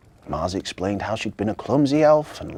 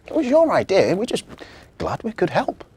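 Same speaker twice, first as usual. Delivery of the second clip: put-on girl voice; 9 semitones higher, 6 dB louder than usual. Victoria voiced.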